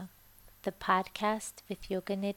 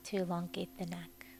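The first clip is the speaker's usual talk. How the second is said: guided meditation. Acted. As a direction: ease the listener gently toward sleep. Leads a Yoga Nidra meditation.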